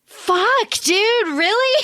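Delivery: as if the plan just got ruined in front of you; exasperated disbelief. Retold or imitated. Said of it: "Norfolk is like".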